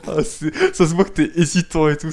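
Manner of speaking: laughing while talking; amused, breaking up into laughs; cracking up. Real, not acted. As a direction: talk through bursts of laughter. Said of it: "ça me fait trop marrer".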